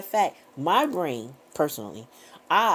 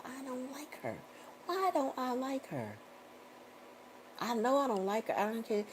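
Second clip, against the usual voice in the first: soft, higher private grumble; inner grumble; 7 semitones higher, 10 dB quieter than usual. Retold or imitated. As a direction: mutter it as a wary thought at a wedding guest. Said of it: "and I go".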